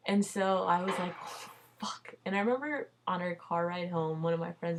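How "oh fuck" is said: breathy whispered "oh, fuck" under the breath; hushed panicked whisper; whispered curse. Retold or imitated. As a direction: whisper it in dismay like you just got caught. Realizing she was outed.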